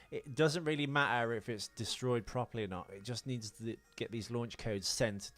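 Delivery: normal talking voice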